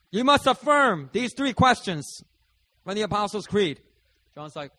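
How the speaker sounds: loud, high preacher voice; booming pastor voice. Retold or imitated. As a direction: boom it out like an officiating pastor.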